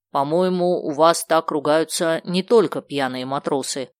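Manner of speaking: usual speaking voice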